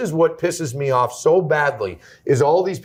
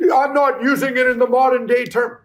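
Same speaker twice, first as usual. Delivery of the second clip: tag imitating opponent; 8 semitones higher than usual. Mimics opponent's debate claim.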